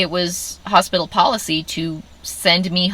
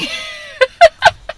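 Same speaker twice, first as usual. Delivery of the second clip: laughing through tears; a high, embarrassed laugh; 7 dB louder than usual. Real, not acted. Laughing at her own tears.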